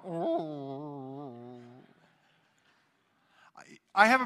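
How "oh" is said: long, drawn-out dog moan; imitated dog whine. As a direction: whine like a dog left without its walk. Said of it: "you'll have a dog go".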